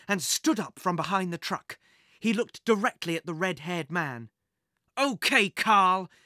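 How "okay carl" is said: yelling. A narrator's reading. Jack yelling at the thief to face him, loud and defiant. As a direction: call him out across the garage. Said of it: "Jack yelled".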